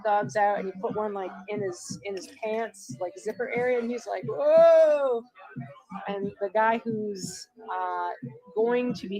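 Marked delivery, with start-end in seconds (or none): 4.2-5.2 loud, high, drawn-out whoa; mock whoa shout. Guy retold showing off his hot dog gag, mock shout. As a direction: call out a big imitated whoa.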